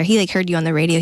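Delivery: ordinary talk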